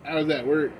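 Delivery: ordinary talk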